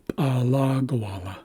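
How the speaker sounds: P'alagawala as pa-LA-ga-wa-la; second-syllable stress. Shown as a demonstration. Teacher repeats the Haisla word, stress on second syllable. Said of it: "shift the accent to the second syllable".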